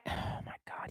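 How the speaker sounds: unvoiced, exasperated whisper; whispered groan at a garbled message. Real, not acted. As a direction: breathe it out in disbelief. Whispered reaction to a typo.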